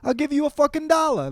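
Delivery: blue-collar boss. Retold or imitated. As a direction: bark it like a boss on his lunch break. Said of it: "He's like".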